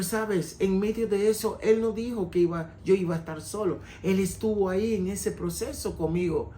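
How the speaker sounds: ordinary talk, nothing marked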